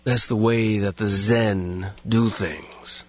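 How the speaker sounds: slow, lazy pseudo-sage; low, drawn-out deadpan guru-cop; Steven Seagal impression. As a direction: pronounce it like deep wisdom, very slowly. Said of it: "the lazy way the words come out".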